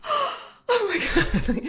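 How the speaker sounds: very high, very slow, laughing gasp of disbelief; stunned delight. Retold or imitated. Seeing a hundred fifty dollars arrive, laughing in disbelief. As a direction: let it stretch out in amazed joy. Payment notification on her watch.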